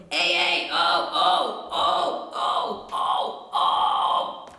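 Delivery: compressed growl, not shouty; twangy "hey" darkening into pitchless "oh"; distorted yells fading into compressed noise; reduced distortion. Shown as a demonstration. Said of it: "to get a reduced sound".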